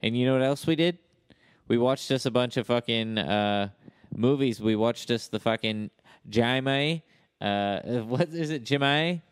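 put-on Aussie, searching for a name; Australian accent. Australian trying to recall a film star, Australian accent. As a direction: keep up the improvised Australian accent. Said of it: "Australian accent".

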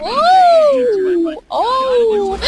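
high, loud excited whoop. Real, not acted. Gamer whooping at a wild crash on screen, loud and high. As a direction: yelp with delight at the stunt.

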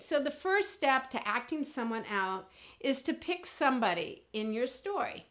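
normal talking voice

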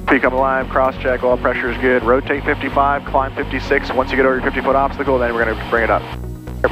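normal talking voice

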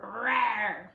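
playful drawn-out leopard growl; leopard snarl. Shown as a demonstration. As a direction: imitate a snarling leopard for children. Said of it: "Can you snarl with that leopard?".

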